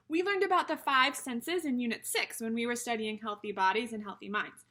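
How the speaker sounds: ordinary delivery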